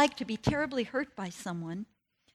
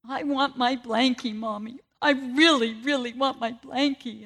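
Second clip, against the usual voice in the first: put-on whiny little boy begging for his blankie, louder on "really"; child pleading for his blanket with Mommy; crying toddler; 4 dB louder than usual. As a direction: imitate the toddler's insistent whimper. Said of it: "started crying again".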